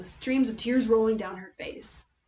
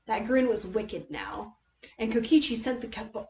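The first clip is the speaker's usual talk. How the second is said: plain reading voice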